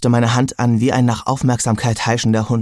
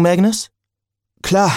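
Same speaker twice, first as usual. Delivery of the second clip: light character voices; 8 semitones higher than usual. A narrator's reading. Percy asks, Magnus coughs reply.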